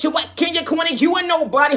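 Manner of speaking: high, loud taunting quote; shrill-free loud mockery of the haters; mock yell. Mocking what people said to her.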